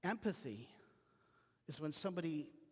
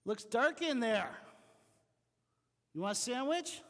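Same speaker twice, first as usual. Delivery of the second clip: breezy sympathizer; 7 semitones higher, 6 dB louder than usual. Retold or imitated. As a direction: call down into the pit cheerfully but detached. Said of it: "they go".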